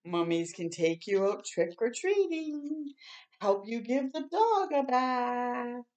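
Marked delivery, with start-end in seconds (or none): none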